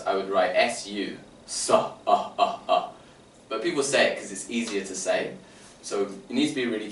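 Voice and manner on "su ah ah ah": "su, ah" drawn out slowly, breathy ah tacked on; extra vowel demo. Shown as a demonstration. Teacher demonstrating the mispronounced s sound. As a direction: slowly show the wrong added ah. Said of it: "If I wrote that in real phonics".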